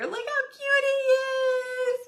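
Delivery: high, slow, near-crying squeal; overwhelmed by cuteness. Real, not acted. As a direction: squeal it like it's too cute to bear. Squealing over baby's photo.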